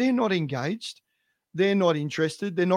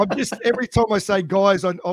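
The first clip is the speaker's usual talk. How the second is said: laughing; 4 semitones higher, 4 dB louder, faster than usual. Real, not acted.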